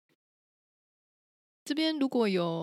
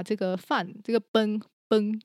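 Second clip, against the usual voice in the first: 饭 punched out louder; stress on 饭; 4 dB louder than usual. Shown as a demonstration. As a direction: hit the word 饭 hard each time. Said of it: "特别强调过一下这个饭". (加重语气强调饭)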